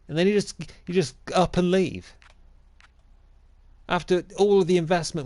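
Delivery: a betrayed, high complaint; indignant outburst. Acted. Mock manager fuming at his player leaving.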